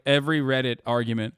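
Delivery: usual speaking voice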